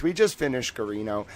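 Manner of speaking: ordinary delivery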